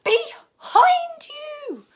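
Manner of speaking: very high, slow, loud shriek; family shrieking a warning; cry of alarm. A narrator's reading. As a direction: scream the warning. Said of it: "cried Mum".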